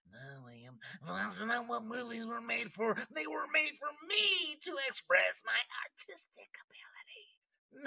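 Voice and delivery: high, airy mocking voice; mock precious artist. Mimics leftist's artistic objection.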